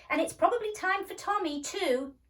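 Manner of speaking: loud, high call; mother calling. A narrator's reading. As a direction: call both children in.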